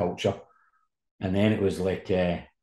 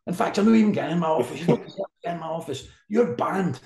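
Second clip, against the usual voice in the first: mock furious rant; 9 semitones higher, 4 dB louder than usual. Manager banning reporter.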